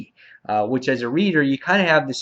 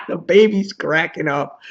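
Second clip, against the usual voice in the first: laughing; 5 semitones higher than usual. Real, not acted. Amused by the scene he retells.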